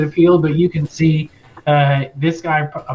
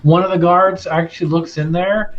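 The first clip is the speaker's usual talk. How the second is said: normal talking voice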